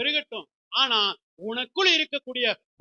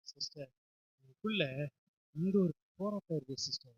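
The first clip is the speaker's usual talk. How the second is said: a much lower, much softer voice begging for prayer; pleading congregant; 10 semitones lower, 9 dB quieter than usual. Acted. Believer asking the sister to pray, low and soft pleading. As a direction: play a troubled believer asking the sister quietly for help.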